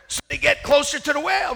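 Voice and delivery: ordinary delivery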